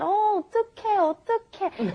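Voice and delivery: high, cute fretting "어떡해"; lightly voiced fretting girl; cute drama girl. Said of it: "lots of girls saying this a lot".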